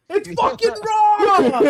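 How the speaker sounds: high, drawn-out chef's roar; mock yell. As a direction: yell it in hammed-up kitchen outrage. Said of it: "we yell out".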